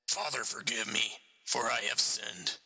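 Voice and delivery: hoarse stranger confessing. Acted. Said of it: "the familiar hoarse voice spoke".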